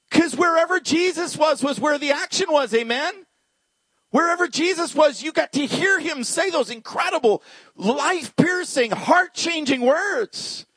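high, ringing excitement; high-pitched, exuberant proclamation; fired-up preaching. Real, not acted. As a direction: preach it with soaring energy. Preacher builds excitement about Jesus.

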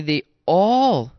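"all" punched loud and long; stress on all. Acted. Preacher to congregation, "all" punched loud. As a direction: lean hard on "all".

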